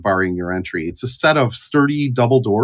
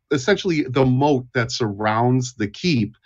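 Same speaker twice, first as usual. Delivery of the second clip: usual speaking voice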